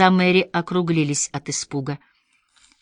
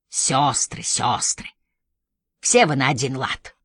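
snapping; 4 semitones lower than usual. A narrator's reading. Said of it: "огрызнулся он".

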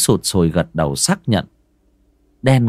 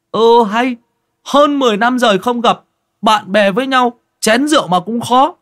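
Ty's voice; 5 dB louder than usual. Acted. Ty protests the refusal.

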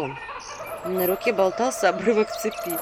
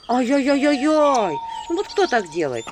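mock scolding; 4 semitones higher, 4 dB louder, slower than usual. A narrator's reading. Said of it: "Грозила я пальчиком".

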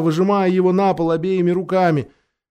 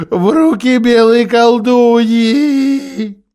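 anguished Faun; 4 semitones higher, 5 dB louder than usual. A narrator's reading. Faun's anguished confession.

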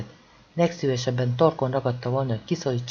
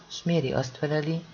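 plain reading voice

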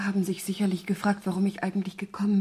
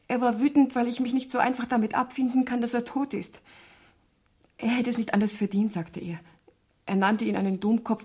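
usual speaking voice

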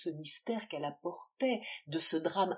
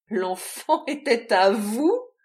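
loud, slow, panting roar; loud panting roar of disbelief; 5 semitones higher, 15 dB louder, slower than usual. Acted. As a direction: roar it out breathless with shock. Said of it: "rugit Adrienne, pantelante".

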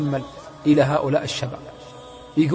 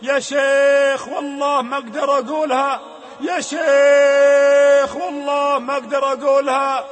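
slow, loud desperate cry; anguished cry; 9 semitones higher, 6 dB louder, slower than usual. Retold or imitated. The dying youth cries out to the sheikh, anguished. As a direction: wail that you cannot say it. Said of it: "وهذا الشاب يصيح".

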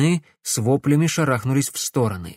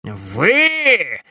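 loud, higher furious outburst; enraged; 6 semitones higher, 4 dB louder than usual. A narrator's reading. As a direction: explode in fury. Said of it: "разъярился Корнелиус".

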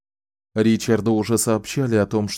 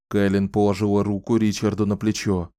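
usual speaking voice

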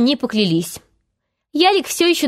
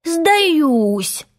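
higher, drawn-out pitiful surrender; plaintive; 6 semitones higher than usual. A narrator's reading. Hook pretends to surrender, plaintive. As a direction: give up in a piteous voice. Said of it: "жалобным голосом".

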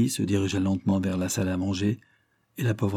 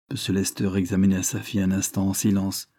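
ordinary delivery

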